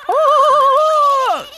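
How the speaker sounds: very high, drawn-out "oh"; long high squeal of amazement; excited whoop. Real, not acted. Reaction as meteor takes off.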